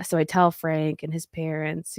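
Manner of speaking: ordinary talk, nothing marked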